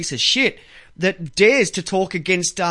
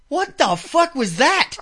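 retold shock; 7 semitones higher, slower than usual. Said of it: "I was like".

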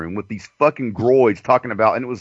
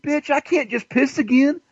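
higher, indignant retold complaint; exasperated mock outburst; 13 semitones higher than usual. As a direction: voice the fed-up retort, pitched up. Retold angry reply to nurse.

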